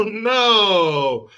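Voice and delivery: usual speaking voice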